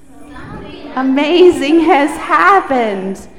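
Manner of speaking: loud group repeat. Real, not acted. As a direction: shout it back together. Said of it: "repeat after me really loudly".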